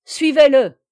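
loud, high command; shouted order. A narrator's reading. Captain orders rowers to follow the barge, shouting. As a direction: call the order out hard.

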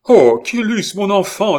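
high, loud exclamation of concern; anguished pleading. A narrator's reading. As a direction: implore a dear child to stop. King pleads with his frantic favourite. (supplication forte et affolée)

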